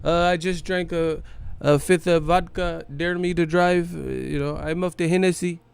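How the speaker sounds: put-on boastful drunk line in a Pacquiao accent; Pacquiao impression. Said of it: "feeling like Pacquiao".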